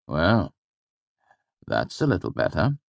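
usual speaking voice

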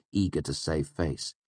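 plain reading voice

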